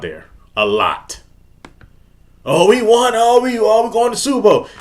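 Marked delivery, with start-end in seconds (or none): 2.4-4.7 high mocking fan voice; mock the delusional fans, gloating.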